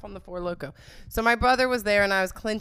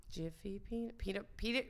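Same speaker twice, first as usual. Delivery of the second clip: ordinary talk, nothing marked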